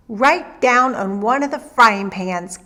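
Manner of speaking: ordinary delivery, nothing marked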